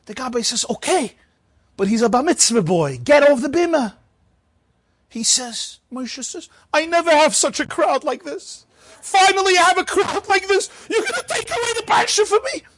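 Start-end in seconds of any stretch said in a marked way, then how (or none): 0.7-3.9 higher, sharp and louder gabbai order; impatient gabbai. Retold or imitated. Gabbai ordering old Moshe off the bima, curt and raised. 6.7-12.6 much higher, rising desperate plea; pleading old man. Retold or imitated. Old Moshe begging the gabbai to let him read, high and desperate.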